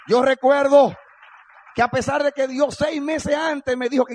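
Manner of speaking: high, ringing testimony from the pulpit; full-force preaching. Real, not acted.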